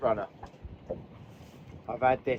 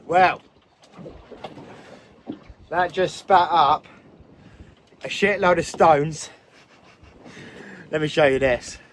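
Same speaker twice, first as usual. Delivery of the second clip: excited; 3 semitones higher, 6 dB louder than usual. Real, not acted. Excited showing the caught bass.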